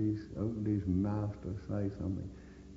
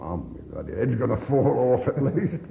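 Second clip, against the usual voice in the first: louder thought, laughing through it; 3 semitones higher, 8 dB louder than usual. Real, not acted. Veteran recalling his head wound, laughing as he tells it. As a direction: laugh at the gory memory.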